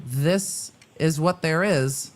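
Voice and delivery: ordinary delivery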